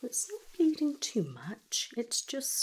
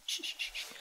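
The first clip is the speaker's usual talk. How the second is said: soothing shush; 4 dB quieter, faster than usual. Acted. Calming upset patient.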